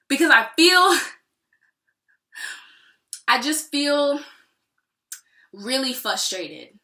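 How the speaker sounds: loud, pitch climbing with frustration; real frustration. Real, not acted. Asking God what she was brought here for, really frustrated. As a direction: frustration boiling up until words halt. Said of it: "I feel really frustrated".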